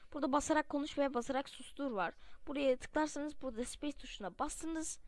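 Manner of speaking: ordinary talk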